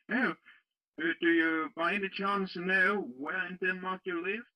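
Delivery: ordinary delivery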